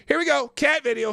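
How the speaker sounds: high, loud cheerful call; excited. Real, not acted. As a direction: hype up the cat clip. Excited intro.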